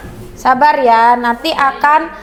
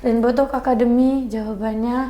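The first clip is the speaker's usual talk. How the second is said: soft, low-key, listless reading; subdued, unenthusiastic intonation; low-energy delivery; 3 semitones lower, 6 dB quieter than usual. Shown as a demonstration. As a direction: say it quietly with no energy. Teacher demonstrates contrasting intonation.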